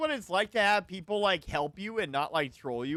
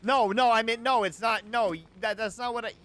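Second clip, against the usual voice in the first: loud protest; 5 semitones higher, 7 dB louder than usual. Real, not acted. Protesting being misquoted.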